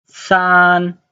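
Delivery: San held high and level; first tone. Shown as a demonstration. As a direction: stretch San on one steady high note. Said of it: "the first tone".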